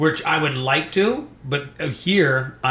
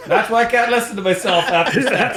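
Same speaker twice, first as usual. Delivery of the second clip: self-mocking laugh; 8 semitones higher, 4 dB louder than usual. Real, not acted. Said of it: "I sound like an absolute idiot".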